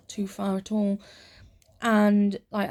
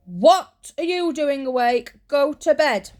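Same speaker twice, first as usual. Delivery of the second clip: a touch of a cross mum, louder, slow; mild mock scolding; 6 semitones higher, 6 dB louder, slower than usual. Mum scolding her awake child at night, mild mock scold. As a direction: tell the child off half in fun. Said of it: "she's like".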